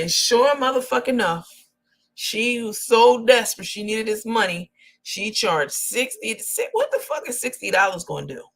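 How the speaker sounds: ordinary talk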